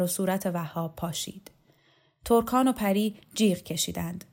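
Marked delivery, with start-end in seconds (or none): none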